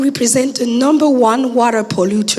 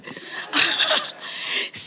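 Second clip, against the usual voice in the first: breathy laugh; 6 dB quieter than usual. Real, not acted. Laughs at her hoarse voice.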